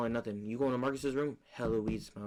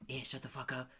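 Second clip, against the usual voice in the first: softer whispered hush; whispered scold; 5 dB quieter than usual. Retold or imitated. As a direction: hiss it under your breath.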